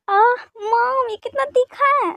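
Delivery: high, drawn-out whine; pained whiny complaint. Acted. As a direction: whine to mom that the food burns. Food too spicy, complains to mom.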